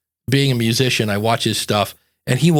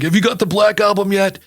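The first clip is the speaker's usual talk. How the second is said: higher, excited question; eager fan; 7 semitones higher than usual. Retold or imitated. Music fan asking friends about the album, eager. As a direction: imitate a keen fan spreading the word.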